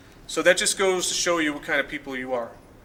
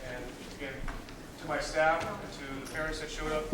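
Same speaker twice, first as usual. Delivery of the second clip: usual speaking voice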